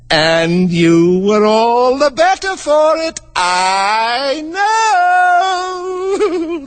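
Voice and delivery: rising high and slow to a gleeful peak; triumphant. Acted. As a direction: crow over the win. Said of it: "the Queen said triumphantly".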